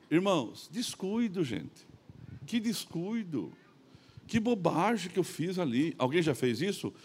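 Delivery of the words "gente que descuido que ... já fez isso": ordinary talk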